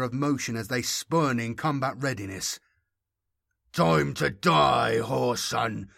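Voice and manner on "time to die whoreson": slow, growled menace, wet and throaty. A narrator's reading. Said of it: "growled Eshmun, his voice wet and throaty".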